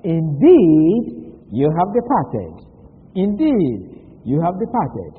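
Indeed punched loud, then you have departed; emphatic rendering. Shown as a demonstration. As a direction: lean hard on Indeed both times. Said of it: "to show the emphasis".

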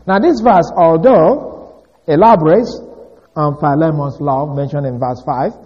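ordinary talk, nothing marked

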